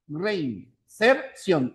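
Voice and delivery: "reinserción" slowly split into rein-ser-ción; syllable by syllable. Shown as a demonstration. Teacher checking a syllable count, "reinserción" slowly split up. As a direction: pause between each syllable of "reinserción". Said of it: "presente cuatro sílabas".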